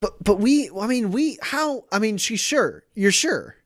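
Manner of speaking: high, stammering, broken-off questions; flustered disbelief. Acted. Leonard checks the pregnancy is certain, flustered disbelief. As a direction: stumble over words, pitched high in astonishment. Stunned father-to-be questions the news.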